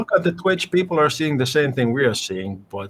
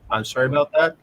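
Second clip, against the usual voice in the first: ordinary talk, nothing marked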